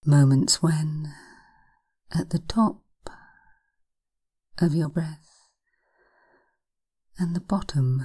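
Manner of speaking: slow, hushed hypnosis voice; breathy guided relaxation, unhurried. Acted. Hypnosis session guiding relaxation.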